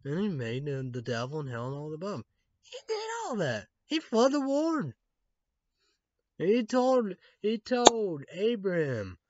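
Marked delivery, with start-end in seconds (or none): 2.6-9.2 much higher and louder, worked up; rising indignation at God; fired-up ranting; heated rant. Real, not acted. Ranting about God's deeds.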